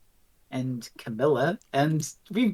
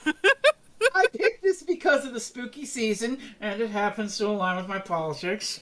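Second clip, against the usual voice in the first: mock indignant; 5 semitones higher than usual. Mock defence after being called liar.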